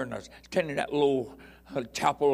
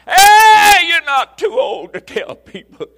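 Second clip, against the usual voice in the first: loud, high preaching call; preaching shout; 19 dB louder, slower than usual. Real, not acted. Preacher exhorting old people in the church, loud shout. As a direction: holler it at the congregation.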